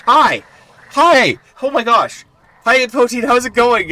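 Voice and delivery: high, loud happy greeting; calling out to new arrivals; excited hello. Real, not acted.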